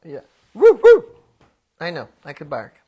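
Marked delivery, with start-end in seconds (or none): 0.5-1.0 high, loud coyote yips; coyote howl. Pretend. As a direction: yip twice like a coyote. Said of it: "I can do a coyote call".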